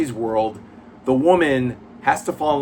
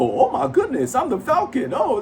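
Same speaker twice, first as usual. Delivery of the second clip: much higher, laughing put-on voice for Falcon; caricature Falcon voice; 12 semitones higher than usual. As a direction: ham up a squeaky high caricature of the hero.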